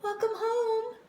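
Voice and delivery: high, sweet mother voice; mother's greeting. A narrator's reading. Mother greeting her daughter home, high and sweet. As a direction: welcome her in warmly and high.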